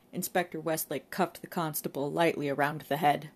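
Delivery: plain reading voice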